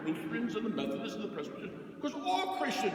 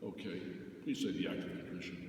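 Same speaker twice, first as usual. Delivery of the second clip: much softer, lower kindly priest; gentle confessor; 8 semitones lower, 8 dB quieter, faster than usual. Retold or imitated. Priest to penitent in confession, soft and gentle. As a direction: reassure the penitent softly. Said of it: "I'll be very nice".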